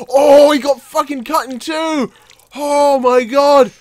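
high, loud, drawn-out shock; appalled, stretched-out exclamation; horrified astonishment. Real, not acted. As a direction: gasp it out slowly in disbelief. Reacting to gory accident.

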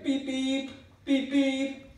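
high, loud honking beeps; horn imitation. Driver imitating the van horn to clear the road, high beeps. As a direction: beep like a car horn.

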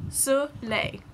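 soleil said soo-LAY, falling; French soleil. Shown as a demonstration. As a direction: say soleil along with the class. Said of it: "try it with me".

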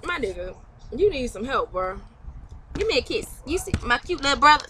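ordinary talk, nothing marked

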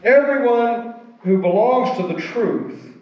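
solemn, emphatic reading, pausing between phrases; scripture reading. Acted. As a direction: proclaim each phrase, starting high and falling to a close. Reads scripture aloud to congregation.

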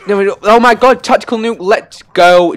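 high, loud burst of triumph; ecstatic shouting. Real, not acted. Gamer finally getting the tactical nuke, ecstatic shouting. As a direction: scream the victory out, easing slightly at the end.

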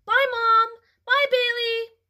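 very high, loud excited farewell; calling out goodbye. A narrator's reading. Cassie calls goodbye to Mom and Bailey, loud and high. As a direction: yell it running out the door.

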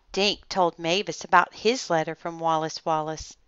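ordinary delivery

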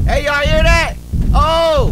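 loud high alarm. Real, not acted. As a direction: react to a sudden noise. Hears something in game.

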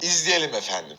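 low, polished presenter phrasing; TV announcer voice. Shown as a demonstration. Repeating the anchor phrase to viewers, TV presenter voice. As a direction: sign off the clip like a news anchor. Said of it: "televizyon spikerlerinin kullandığı bir laf".

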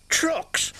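affronted, high squawk of Trucks?; indignant snort. Acted. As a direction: spit out Trucks? in disgust. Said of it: "snorted Sir Handel".

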